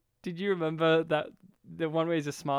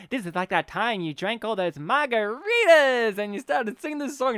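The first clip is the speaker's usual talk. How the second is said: foppish friend; 5 semitones higher, 6 dB louder than usual. Retold or imitated. Foppish office guy quoted.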